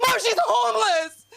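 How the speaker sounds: high, loud hammed-up sob; mock wail. Mock wailing to grandma.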